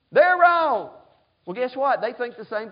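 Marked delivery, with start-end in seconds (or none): 0.1-0.9 loud, high "They're wrong"; mock indignant shout. Preacher mocking an indignant member, loud and high. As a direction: blurt the blame back, mocking.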